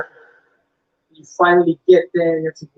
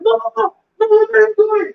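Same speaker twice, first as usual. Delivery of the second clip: mock panicked guard; 13 semitones higher, 4 dB louder than usual.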